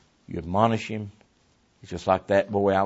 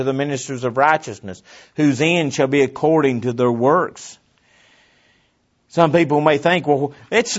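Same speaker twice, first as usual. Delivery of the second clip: raised, louder pulpit voice; forceful preaching; 5 semitones higher, 6 dB louder than usual. Real, not acted.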